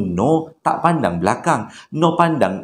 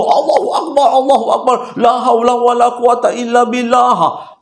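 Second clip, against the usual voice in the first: higher and louder panicked chanting; terrified trembling prayer; 10 semitones higher, 4 dB louder than usual. Retold or imitated. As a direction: pray in terror, voice pushed up. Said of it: "dengan menggigil-gigil".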